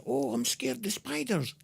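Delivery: high, soft mocking whine of a scared guest; mock scaredy-cat. Podcaster mocking timid reality show contestants, high mock whine. Mocking people who name fears.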